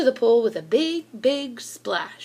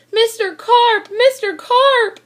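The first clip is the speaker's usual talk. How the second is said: very high, loud calling out; yelled; 10 semitones higher, 8 dB louder, slower than usual. A narrator's reading. Boy yelling for Mister Carp who vanished underwater, loud high call. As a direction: call out across the pool. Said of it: "I yelled".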